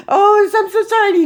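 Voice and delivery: high, loud, apologizing through laughter; laughing apology. Retold or imitated. Owner apologizing to frothing cat, high loud laughing. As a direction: plead sorry half laughing, half wailing. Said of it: "it's like".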